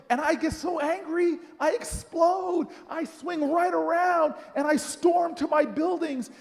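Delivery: high, heated retelling; worked-up anger. Retold or imitated. Storming off from Miss Jones, worked-up anger. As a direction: build into a mock explosion. Storyteller enacts exploding with anger.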